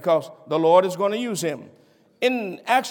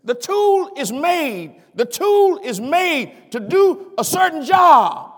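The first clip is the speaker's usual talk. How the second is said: high, emphatic preacher's delivery; rising preaching; 8 semitones higher, 3 dB louder than usual. Acted. Preacher likening God's servants to a mechanic's tools, high emphatic preaching. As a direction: preach it high, leaning on the key words.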